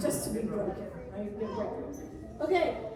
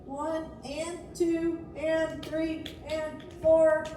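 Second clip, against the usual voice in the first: slow, even count of the beats; measured teacher count; much slower than usual. Shown as a demonstration. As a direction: count it out slowly for the class. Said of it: "It would be easy".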